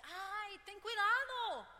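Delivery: loud, very high, slow shout; yelled warning. Retold or imitated. Relative yelling a warning after a stubbed toe, loud high shout.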